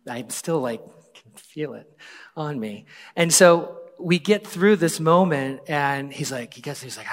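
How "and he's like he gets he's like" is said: normal talking voice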